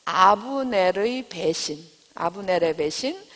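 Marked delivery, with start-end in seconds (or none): 0.0-1.8 title spelled out very slowly for note-taking; slow dictation. Shown as a demonstration. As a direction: dictate each syllable slowly so they can write. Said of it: "그리고 제목은".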